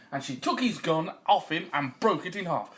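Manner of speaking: plain reading voice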